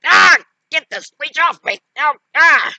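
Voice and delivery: loud, high hammed-up shrieks; cartoonish frantic panic; panicked screaming. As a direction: scream to get it off. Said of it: "going like".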